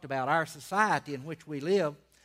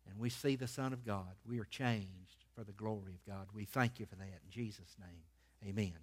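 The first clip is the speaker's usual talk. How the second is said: hushed, lower prayer sinking toward the amen; reverent prayer; 4 semitones lower than usual. Real, not acted. Preacher thanking the Father for the reading, reverent prayer. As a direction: pray humbly, phrase by phrase.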